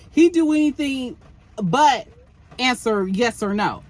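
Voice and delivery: usual speaking voice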